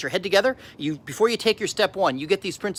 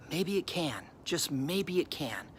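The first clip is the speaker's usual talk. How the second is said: much softer, slowing down; gentle encouragement; 3 semitones lower, 12 dB quieter, slower than usual. Real, not acted. Speaker to those who think it can't be, gentle encouragement. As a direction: drop to a hushed, hopeful reassurance.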